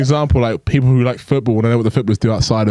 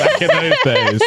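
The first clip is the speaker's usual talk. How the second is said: much higher and slower, laughing, mocking the grammar; mock posh correction; slower than usual. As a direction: correct her with a laughing, prissy lilt. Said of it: "there was no videos back in them days".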